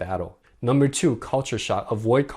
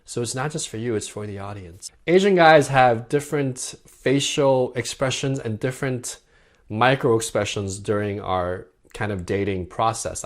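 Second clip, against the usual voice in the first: usual speaking voice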